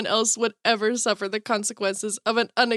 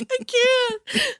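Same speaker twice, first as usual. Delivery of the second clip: mock helpless laughing; 11 semitones higher than usual. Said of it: "And I'm like".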